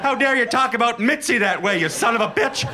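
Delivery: high, huffy mock outrage; a cat owner clutching pearls; offended cat lover.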